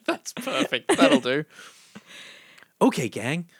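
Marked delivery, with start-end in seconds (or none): 2.8-3.5 lightly voiced cartoon leader; a hero greeting the team; Fred impression. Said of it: "a Fred voice".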